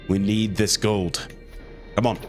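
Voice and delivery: ordinary talk, nothing marked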